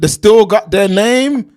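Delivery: clarifying repeat after a huh. Real, not acted.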